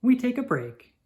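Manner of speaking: plain reading voice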